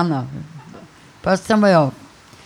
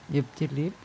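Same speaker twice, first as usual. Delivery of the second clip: "Ich hab die lieb" again, much softer, almost under the breath; soft repeat; 6 semitones lower, 13 dB quieter, faster than usual. Shown as a demonstration. Repeating her dialect love declaration, much softer. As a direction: repeat it gently to yourself. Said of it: "Wie klingt's im Dialekt".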